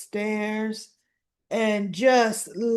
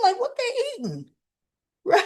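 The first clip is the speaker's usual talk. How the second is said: laughing, high; mock puzzled; amused high question; 13 semitones higher, faster than usual. Real, not acted. Fan laughing at hot dogs on the plate, amused high. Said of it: "laughing at hot dogs".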